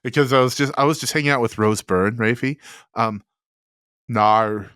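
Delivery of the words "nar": Australian "nar" for no, long drawn vowel. Pretend. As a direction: say "nar" like an Aussie saying no. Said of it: "can I hear your best nar?".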